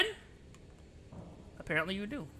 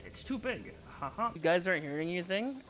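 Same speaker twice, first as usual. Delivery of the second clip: normal talking voice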